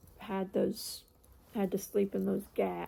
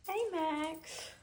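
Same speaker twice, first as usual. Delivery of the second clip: pet greeting; 7 semitones higher than usual. Real, not acted. Greeting dog Max.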